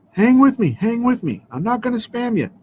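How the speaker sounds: high, coaxing reassurance; pleading. Real, not acted. Pleading with viewers.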